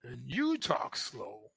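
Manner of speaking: dragging each word out to mirror a slow talker; slow talk demo. Shown as a demonstration. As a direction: show what talking slow sounds like. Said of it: "if they talk slow".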